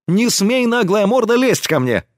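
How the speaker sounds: high-pitched indignant rebuke; indignant outburst. A narrator's reading. Girl rebuking the man who kissed her, indignant. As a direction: tell him off sharply, pitched well up. Girl snaps after a slap.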